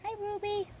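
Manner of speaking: high cooing greeting; pet voice. Real, not acted. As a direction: coo to a dog. Greeting her dog.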